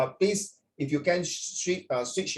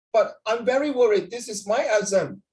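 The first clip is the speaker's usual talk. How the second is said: higher-pitched worried student with a hint of Indian accent; slight Indian accent; 7 semitones higher than usual. Indian student worrying to his tutor over the exam, slight Indian accent. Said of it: "he got a bit of Indian accent".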